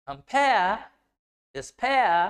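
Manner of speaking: long, strong "pair" in compare and despair; stressed final syllable. Shown as a demonstration. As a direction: stretch and punch the last syllable of compare, despair. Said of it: "इसको लंबा और loud बोला जा रहा है". (Compare, despair का आख़िरी syllable लंबा और ज़ोर से)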